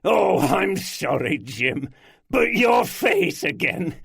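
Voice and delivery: loud, high, laughing through words. Acted. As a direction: burst out laughing apologising. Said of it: "burst into laughter".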